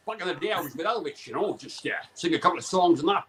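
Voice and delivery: lilting Welsh accent, showbiz raconteur; put-on Welsh celebrity accent. Shown as a demonstration. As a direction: reminisce in a sing-song Welsh accent. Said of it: "you got a bit of [name] in you?".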